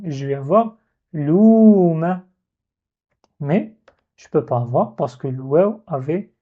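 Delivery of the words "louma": "louma" with a long held "ou", slow; prolonged "louma". Shown as a demonstration. Teacher shows the vowel lengthened, slowly. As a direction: draw out the "ou" in "louma". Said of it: "je vais avoir un prolongement".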